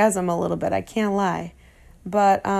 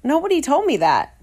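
mock surprise; 8 semitones higher, 3 dB louder than usual. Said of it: "And I said".

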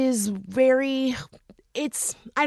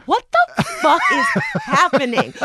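laughing disbelief, pitch shooting way up; incredulous. Retold or imitated. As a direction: react in stunned, laughing disbelief. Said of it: "And I was like".

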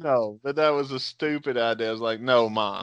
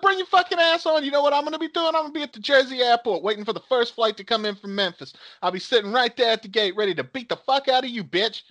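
retold angry dare; 9 semitones higher, faster than usual. He dares the caller to fly in, mock furious. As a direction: goad the caller into a fight.

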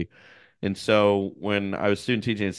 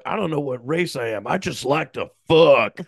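imitating a comic; 7 semitones higher, 4 dB louder than usual. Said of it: "a guy being like".

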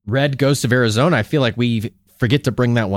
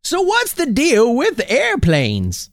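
high slow hammed-up stand-up line; old prospector doing stand-up; stand-up comic voice; 9 semitones higher, slower than usual. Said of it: "old prospector does a tight five".

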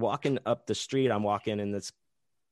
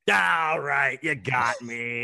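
loud, high start, slow finish; hammed-up conceding; 4 semitones higher, 5 dB louder, much slower than usual. Conned buyer giving up the hour-long search, conceding. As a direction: call out defeat to the absent swindler.

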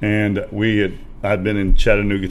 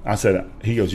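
usual speaking voice

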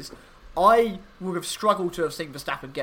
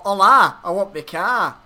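louder, jokey attempt at a Mexican accent; playful mock Mexican accent; 3 semitones higher, 10 dB louder than usual. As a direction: put on a rough Spanish-speaker accent. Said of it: "in Mexican, probably. Spanish is what they speak".

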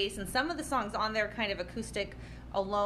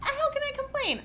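mock innocent. Real, not acted. Mock rhetorical complaint.

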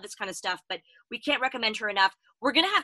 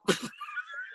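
high squealing laugh; 6 dB quieter than usual. Real, not acted. Breaks up laughing.

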